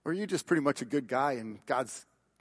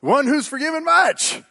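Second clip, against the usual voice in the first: eager answer; 11 semitones higher, 11 dB louder than usual. Retold or imitated. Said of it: "he can answer this".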